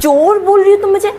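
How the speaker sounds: loud, high-pitched indignant retort; indignant outrage. Acted. Aunty snaps back, insulted at being called a thief, loud and outraged.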